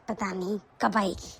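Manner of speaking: a low impatient mumble to herself; low weary mutter. Acted. Mother waiting for her daughter, muttering low and soft.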